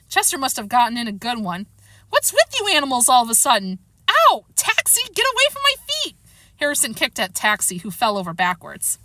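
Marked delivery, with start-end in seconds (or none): 2.1-6.2 pained, alarmed yelling. Acted. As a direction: protest rising to high yelps. Harrison yelps while being attacked.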